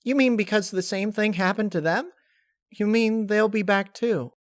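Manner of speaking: high, wondering questions; high hopeful asking; hopeful Pinkie. Acted. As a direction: grab onto new hope.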